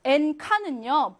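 ordinary delivery

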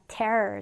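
"tear" said like "tare", with an open eh; verb pronunciation. Shown as a demonstration. Teacher shows students the verb, "tear" pronounced like "tare". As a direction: say "tear" to rhyme with "air". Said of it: "자, 그러면 동사로는".

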